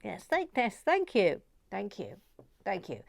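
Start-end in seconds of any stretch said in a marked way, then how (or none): none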